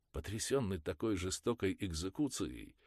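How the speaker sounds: ordinary delivery, nothing marked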